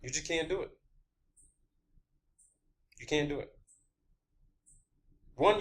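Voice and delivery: usual speaking voice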